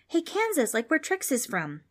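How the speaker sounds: put-on bright high boy's interjection; eager boy Darren. A narrator's reading. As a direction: chime in like a child making a connection. Darren voiced.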